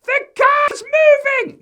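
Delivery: put-on very high, loud, slow child's scream; panicked scream. Eddie screaming as the car rolls away. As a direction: shriek it in terror. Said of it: "He's screaming".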